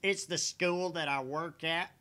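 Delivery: haughty character voice. Acted. In-character huffy reply.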